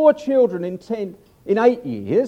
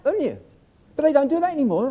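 ordinary talk, nothing marked

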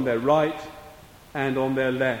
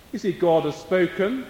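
ordinary talk, nothing marked

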